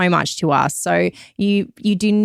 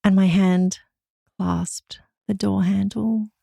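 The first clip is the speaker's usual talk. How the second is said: slow breathy whisper; sultry whisper; slower than usual. Pretend. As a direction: whisper it slowly and seductively. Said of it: "my sexy voice".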